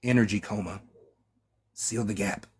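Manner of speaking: each phrase fading to nothing; weak dying voice. A narrator's reading. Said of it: "his voice a rasping whisper".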